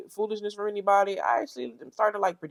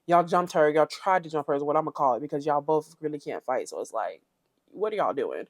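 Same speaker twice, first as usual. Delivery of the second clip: ordinary talk, nothing marked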